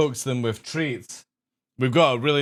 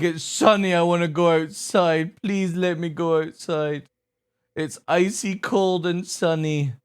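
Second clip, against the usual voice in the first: higher, drawn-out begging as the dog; pleading dog voice; 5 semitones higher than usual. Pretend. Owner voices the husky begging to go outside, pleading. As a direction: whine it out like a dog wanting out. Voicing the dog's plea.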